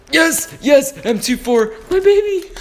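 high, loud burst of excitement; thrilled outburst. Real, not acted. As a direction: squeal it out, overjoyed. Thrilled at finding a weapon.

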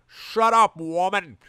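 high, loud hammed-up roar. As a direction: bark it over her. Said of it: "roared Garfield".

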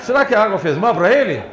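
laughing. Real, not acted. Laughing while showing baby photos.

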